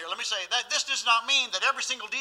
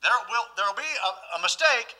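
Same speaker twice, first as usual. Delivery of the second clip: loud, high, fervent preaching; full-force preaching; 4 semitones higher, 6 dB louder than usual. Acted.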